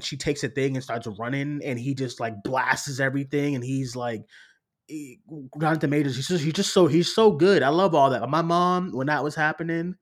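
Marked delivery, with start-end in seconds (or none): none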